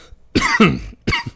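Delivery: sudden harsh cough; rough cough from the chest; chesty cough. Real, not acted. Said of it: "excuse me".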